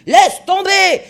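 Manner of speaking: beuglement très haut et très fort; beuglé. A narrator's reading. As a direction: beugle l'ordre d'un coup. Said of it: "quand Olsen beugla". (le capitaine beugle l'ordre de la lâcher)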